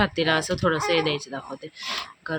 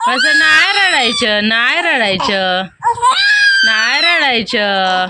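loud, slow, sing-song comforting over the crying; drawn-out lullaby-like comfort; 5 semitones higher, 10 dB louder, slower than usual. Real, not acted. Mother calms crying baby. (रडणाऱ्या बाळाला मोठ्या, संथ, गोंजारणाऱ्या आवाजात समजावणे)

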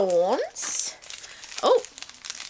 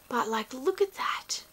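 whispered admiration; awed whisper; 9 dB quieter than usual. Real, not acted. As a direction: hush it, amazed. Admiring the diary.

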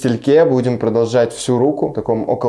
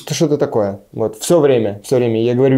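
usual speaking voice

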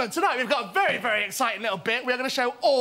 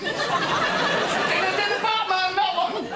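put-on calling kids to dinner; 6 semitones higher, much slower than usual. Mum calling son in for dinner.

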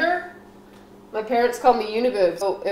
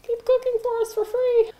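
much higher squealing fan voice; gushing fans. Retold or imitated. Fans begging her to keep cooking, high squeal. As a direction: gush like a starstruck fan. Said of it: "And they'll be like".

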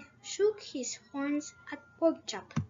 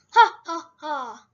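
loud laugh; 7 dB louder than usual. A narrator's reading. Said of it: "laugh loudly".

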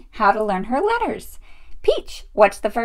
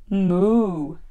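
low, drawn-out moo; cow's moo; 5 semitones lower than usual. A narrator's reading. Showing the letter M, low cow moo. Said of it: "says the milk cow".

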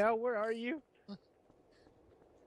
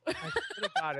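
laughing; 3 dB louder, slower than usual. Real, not acted. Laughing in panic.

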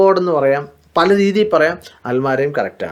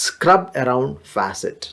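slow model phrase; 2 semitones lower, 4 dB quieter, much slower than usual. Shown as a demonstration. Teacher modelling a new word slowly for students. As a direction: stretch out each word of declutter the closet. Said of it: "ടാപ്പുകൾ ചുറ്റും തേച്ച് കഴുകുക".

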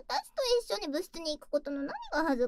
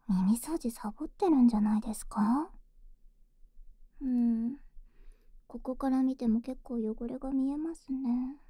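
right in your ear. Acted. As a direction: whisper close to the ear. Ear-cleaning roleplay in whispers.

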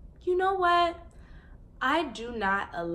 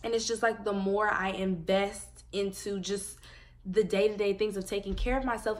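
ordinary talk

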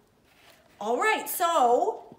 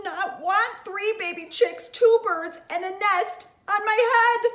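Elephant, upset; 5 semitones higher than usual. Acted. Elephant voice in read-aloud.

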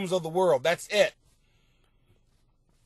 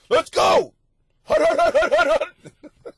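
high, loud quarterback hut calls; football hut calls; 13 semitones higher, 9 dB louder than usual. Pretend. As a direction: bark the snap count like a quarterback. Babies rushing out, football call.

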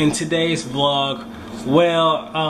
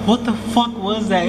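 alarmed; 6 semitones higher than usual. Real, not acted. Reacting to loud bang.

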